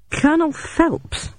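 high, slow baffled question; stammering. A narrator's reading. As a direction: falter on it, taken aback. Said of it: "I stammered".

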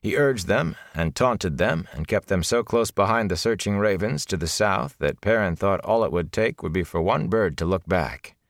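ordinary delivery

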